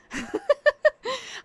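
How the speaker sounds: squeaky high laugh; short high-pitched laugh; high giggle. Real, not acted. Joke about Walmart clothes.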